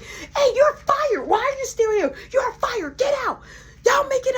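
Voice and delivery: outraged manager ordering him out; furious manager. Retold or imitated. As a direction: boss screaming you're fired. Manager firing a worker.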